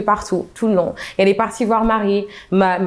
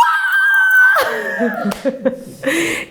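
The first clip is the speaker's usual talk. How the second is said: laughter. Real, not acted. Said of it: "laughs at her own imitation".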